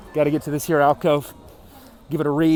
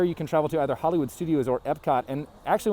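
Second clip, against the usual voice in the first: ordinary delivery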